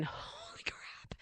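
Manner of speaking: breathless hushed whisper of shock; a whispered holy crap at the power strip; stunned whisper. Retold or imitated. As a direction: mutter it under your breath, aghast. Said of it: "I'm like going".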